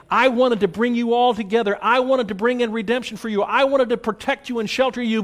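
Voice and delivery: high, loud preaching cry; impassioned plea. Acted. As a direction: plead with all your heart. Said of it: "Jesus is saying".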